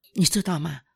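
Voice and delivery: ordinary talk, nothing marked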